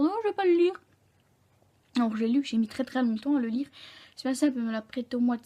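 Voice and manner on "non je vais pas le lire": mock defiant refusal. Imagined refusal of the teacher's book.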